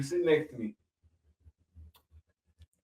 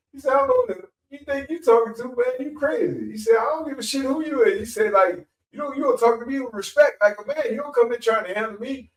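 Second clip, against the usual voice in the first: much higher, louder talking back; higher pitched defiant boy snapping at the reverend; defiant kid; 10 semitones higher, 4 dB louder than usual. Retold or imitated. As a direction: mouth off at the pastor. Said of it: "And so Ricky said".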